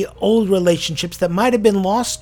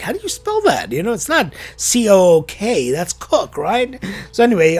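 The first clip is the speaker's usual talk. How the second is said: normal talking voice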